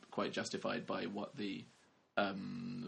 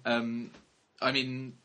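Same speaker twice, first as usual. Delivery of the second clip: ordinary delivery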